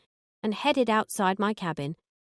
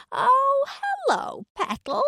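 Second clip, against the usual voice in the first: much higher, loud Yorkshire shriek; shrieking Gloria; 4 dB louder, slower than usual. Acted. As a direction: shriek it like an excited Yorkshire lady. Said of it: "shrieked Gloria".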